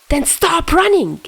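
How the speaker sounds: high, sharp angry shout; snapping. Acted. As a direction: snap it out furiously. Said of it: "Chuya snapped".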